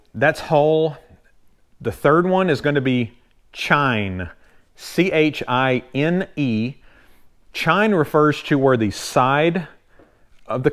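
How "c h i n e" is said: "C H I N E" spelled slowly, letter by letter. Shown as a demonstration. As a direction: spell "C H I N E" out, one letter at a time. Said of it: "I'm gonna spell some of these out".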